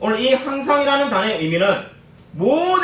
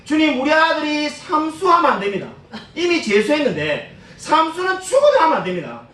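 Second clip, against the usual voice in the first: high-pitched mock outcry to God; imitated prayer cry; 8 semitones higher than usual. Preacher imitates an exam student's parent pleading with the Lord, high-pitched cry. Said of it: "얼마나 크게 외치는지".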